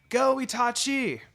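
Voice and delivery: loud, high cheer from the stands. A narrator's reading. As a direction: cheer him on at full voice. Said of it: "Izumi cheered".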